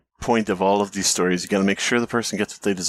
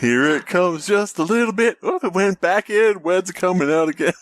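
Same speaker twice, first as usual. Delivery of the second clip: creepy teasing voice; 7 semitones higher than usual. Pretend. Creepy jingle teaser.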